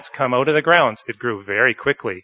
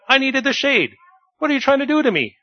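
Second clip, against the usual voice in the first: much higher, whiny strained yell; 13 semitones higher, faster than usual. Acted. Jonah keeps yelling at God about losing his shade. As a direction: keep wailing the complaint up high. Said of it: "yelled Jonah".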